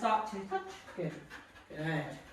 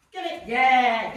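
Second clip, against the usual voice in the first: excited cheer; 2 semitones higher, 11 dB louder, much slower than usual. Real, not acted. Cheering the dog.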